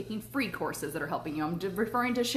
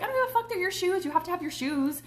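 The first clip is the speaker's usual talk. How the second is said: exasperated insistence; 11 semitones higher than usual. Retold or imitated. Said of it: "And I was like".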